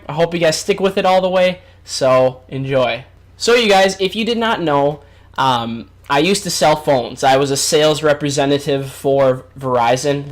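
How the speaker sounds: normal talking voice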